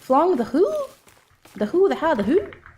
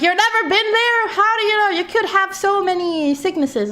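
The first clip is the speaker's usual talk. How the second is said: mock alarm, much higher and louder; alarmed friends; 8 semitones higher, 4 dB louder than usual. Streamer imitating friends scaring her about doctors, mock alarm. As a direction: imitate shocked people warning her. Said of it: "they scare me. They're like".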